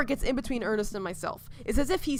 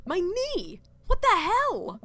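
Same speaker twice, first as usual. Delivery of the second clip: higher, startled outcry over the knee; shocked; 10 semitones higher, slower than usual. Acted. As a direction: yelp in disbelief.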